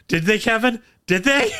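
high, loud laughing taunt; teasing. Real, not acted. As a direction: egg him on, squealing with glee. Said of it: "teasing Kevin".

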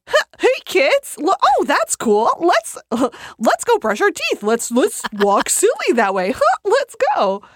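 put-on high, loud, bouncy kids' show host, Blippi style; a goofy Blippi voice with laughs breaking through; high, cheery Blippi voice for toddlers. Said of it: "Show us your Blippi voice".

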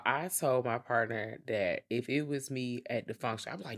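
ordinary delivery

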